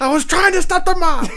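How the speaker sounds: very high, loud movie-character outburst; Gary Oldman. Acted. Player repeating his actor impression on request, high and loud. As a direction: belt out the hammed-up impression, way up high. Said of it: "Do that again".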